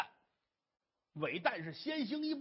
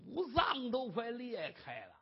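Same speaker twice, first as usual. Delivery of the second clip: plain reading voice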